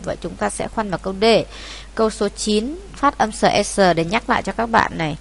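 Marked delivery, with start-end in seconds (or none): none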